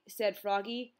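usual speaking voice

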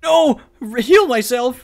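loud high shout, slow on heal myself; outraged yell. Real, not acted. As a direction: scream at the screen. Game orders heal, outraged.

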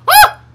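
short high, loud shriek; sharp scream of surprise; startled yelp. Real, not acted. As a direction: yelp as if suddenly dropped. Player flung through the floor.

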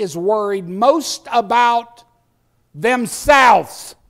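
loud, insistent sermon delivery; forceful preaching. Real, not acted. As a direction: press the charge on the congregation.

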